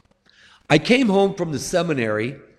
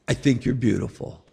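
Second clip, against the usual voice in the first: lower, softer whisper of love; tender God; 6 semitones lower, 4 dB quieter than usual. Retold or imitated. God speaking to each sinner by name, lower and soft. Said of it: "He'll call you by name".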